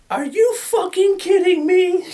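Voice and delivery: high, loud, slow outraged shriek; mock outraged girlfriend. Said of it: "she busts out".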